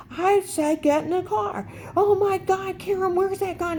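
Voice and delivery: shrieking like a man losing it; hysterical meltdown. Retold or imitated. Dave melting down over the gun, high hysterical mock. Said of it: "he's like".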